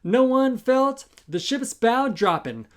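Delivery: plain reading voice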